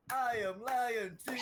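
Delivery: high, slow and hammed-up; mock soundboard voice. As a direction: stretch it out high and silly. Quoting the soundboard clip again.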